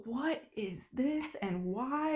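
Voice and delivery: disgusted. Retold or imitated. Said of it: "And I was like".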